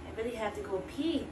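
ordinary talk

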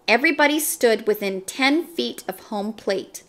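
ordinary delivery, nothing marked

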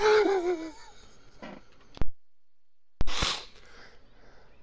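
high, loud laughing fit running out of air; real helpless laugh; breathless laughter. Real, not acted. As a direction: laugh until winded. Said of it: "I'm out of breath".

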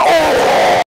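high, loud, slow startled cry; mock monster shout. Scary monster attacking, hammed-up loud yelp. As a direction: belt out a cartoon monster yelp. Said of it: "when the scary monster attack, they're like".

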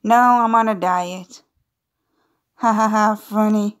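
normal talking voice

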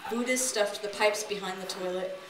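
ordinary delivery

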